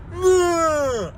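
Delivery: high, loud imitated scream; mock bellow of a kid; imitating the kid's scream, high and loud; imitated roaring bellow. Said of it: "roaring bellow from a Gregorian god".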